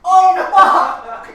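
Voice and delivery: sudden loud startled yelp; surprised shout. Real, not acted. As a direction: blurt it out in shock. Egg smashed on head.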